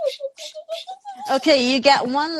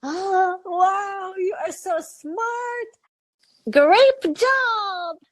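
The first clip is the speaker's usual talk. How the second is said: excited praise; 7 semitones higher than usual. Real, not acted. Enthusiastic praise of the child.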